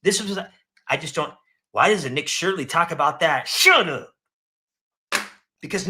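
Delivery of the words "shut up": higher, louder snap; loud angry dismissal; shouted shut up. Real, not acted. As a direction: bark it at the screen. Telling critic off mid-rant.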